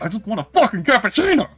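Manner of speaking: fed up with repeating the order; exasperated outburst. Retold or imitated. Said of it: "And I was like".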